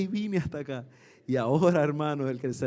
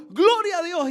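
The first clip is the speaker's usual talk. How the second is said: high, loud cry of praise; retold glad shout from the back; joyful shout; 12 semitones higher, 7 dB louder than usual. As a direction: burst out praising God. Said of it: "pegó un grito así".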